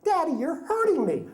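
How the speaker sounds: put-on high child's whine; little girl whine. Daughter performing for the moms in a high, whiny child voice. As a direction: play the wounded kid for the audience. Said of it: "so she goes".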